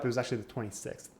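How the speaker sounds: ordinary talk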